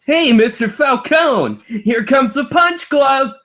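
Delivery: high, loud cartoon kid yelling; cartoon kid shout. [name] yells at his teacher, high and gleeful.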